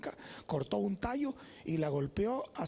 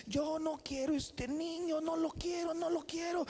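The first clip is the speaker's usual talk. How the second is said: high-pitched mock crying protest; imitated tearful wail; 10 semitones higher, slower than usual. Sister refusing the baby to her brother, imitated crying. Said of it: "encuentro a mi hermana llorando y dice".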